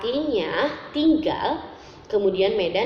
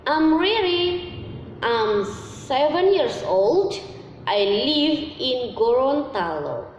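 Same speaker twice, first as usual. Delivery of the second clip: slow, careful textbook English read-aloud; model reading; 5 semitones higher, slower than usual. Shown as a demonstration. Teacher reading English examples to young pupils, slow and careful. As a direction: read each sentence slowly for children to repeat.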